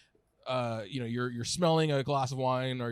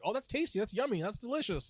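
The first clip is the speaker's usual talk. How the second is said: mocking; 8 semitones higher, 4 dB quieter, faster than usual. Mocking bland food words.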